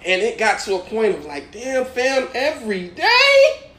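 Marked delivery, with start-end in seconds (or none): 1.5-3.7 high-pitched, incredulous complaint; exasperated protest. Retold or imitated. Bullied freshman protesting to his older tormentor, exasperated. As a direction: plead in a high voice, fed up with it.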